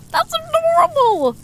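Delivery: very high-pitched squeal of delight; gushing. Acted. Streamer hears of a viewer's new kitten, gushing. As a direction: gasp and coo way up high.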